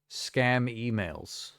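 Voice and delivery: usual speaking voice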